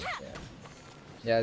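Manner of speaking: normal talking voice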